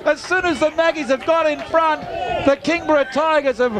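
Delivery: high, loud live match call, building to shouts; excited play-by-play. Real, not acted. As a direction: call the footy live, yelling the goals.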